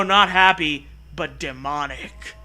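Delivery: ordinary talk, nothing marked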